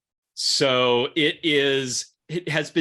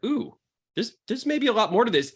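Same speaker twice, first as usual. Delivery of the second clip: surprised realization; 10 semitones higher than usual. Retold or imitated. Realizing the wine has more depth.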